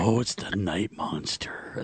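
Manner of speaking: put-on creepy child whisper. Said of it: "That's exactly how she'll say it".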